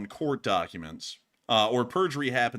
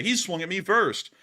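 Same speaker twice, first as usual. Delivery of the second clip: higher-pitched protest to the cops; mock indignant accuser; 8 semitones higher than usual. Lying to the cops who show up after the fight, mock indignant. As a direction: plead innocence in a raised pitch. Acting a liar to police.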